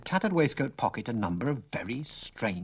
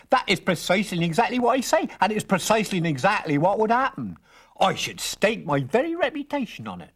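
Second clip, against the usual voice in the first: put-on higher, insistent old man; emphatic old stranger; 10 semitones higher, 3 dB louder than usual.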